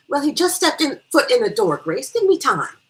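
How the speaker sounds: high, loud, quick-tempered comeback; impatient retort. Acted. Hostess to her nagging friend, impatient retort. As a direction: push back loudly at a nagging friend.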